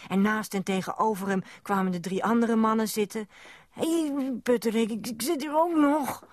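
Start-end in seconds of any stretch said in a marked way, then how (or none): 3.7-6.2 high, strained protest; protesting call-out. Acted. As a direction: call out high to get noticed. Squashed child protests to ignoring men. (hoog protesterend roepen)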